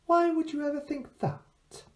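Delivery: put-on high, childlike girl voice; innocent girl. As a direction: sound like a sweet innocent child.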